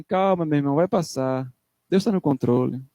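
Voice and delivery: mais grave e suave, um consolo meloso imitado; consolo brando imitado. Retold or imitated. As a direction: imite um irmão dando um consolo fácil. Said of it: "ele fala assim". (irmão consolando o aflito com voz mansa e grave)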